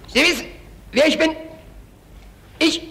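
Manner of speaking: high, loud barked commands; barking like Eichmann. Retold or imitated. Witness imitating Eichmann barking at him. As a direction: bark it at him like an SS officer. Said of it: "ich möchte sagen, bellte mich an".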